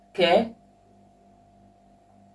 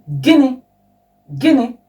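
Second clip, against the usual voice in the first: sharp, higher and louder indignant "Gịnị? Gịnị?"; offended retort, louder and higher; displeased; 7 semitones higher, 7 dB louder than usual. Shown as a demonstration. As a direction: snap it back at someone who annoyed you. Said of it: "something that you're not happy about".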